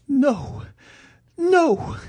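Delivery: very high, wailing cries; frantic high-pitched refusal; vociferated protest. Acted. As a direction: cry out in a piercingly high woman's voice. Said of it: "she vociferated".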